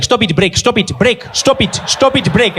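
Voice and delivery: put-on high-pitched, accented exasperated dad; hammed-up harried father, pitch climbing; scolding father. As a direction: scold a child in rising exasperation. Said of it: "his dad was there like".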